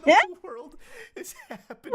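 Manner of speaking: high, loud laughing outcry; cracking up in disbelief. Real, not acted. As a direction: blurt it out through laughter.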